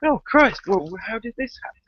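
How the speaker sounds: a hammed-up "Oh, Christ" of disbelief; mock dismay. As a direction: gasp it out like a shocked bystander. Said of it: "everyone's turning around and going".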